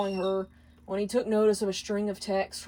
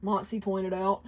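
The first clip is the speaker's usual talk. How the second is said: ordinary delivery, nothing marked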